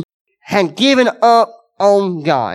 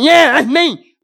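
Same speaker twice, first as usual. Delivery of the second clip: higher, louder mock boast; charged-up Gideon; 7 semitones higher, 4 dB louder, slower than usual. Gideon hearing he's a mighty man, excited boast. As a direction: play Gideon pumped up by the praise. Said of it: "You think Gideon, like".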